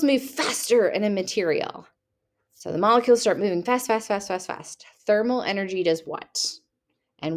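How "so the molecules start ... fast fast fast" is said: fast. Shown as a demonstration. Molecules moving faster and faster.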